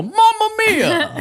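very high, slow cartoon Italian wail; Italian character hit, wailing; Italian guy cry. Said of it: "get hit by something and go".

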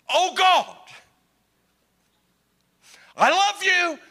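loud high anguished prayer; pleading parent shouting to God; parent crying out. Retold or imitated. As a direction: cry out like a desperate parent.

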